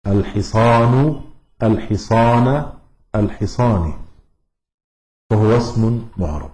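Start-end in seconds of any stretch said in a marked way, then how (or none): 0.1-1.2 الحصان drawn slowly to a final "u"; الحصان ending on a rising damma; nominative ending. Shown as a demonstration. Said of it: "يرفع بالضم". 1.6-2.7 الحصان drawn slowly to a final "a"; الحصان ending on a rising fatha; accusative ending. Shown as a demonstration. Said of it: "وينصب بالفتح". 3.1-3.9 الحصان with a final "i", tune falling; الحصان closed on a falling kasra; genitive ending. Shown as a demonstration. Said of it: "ويجر بالكسر".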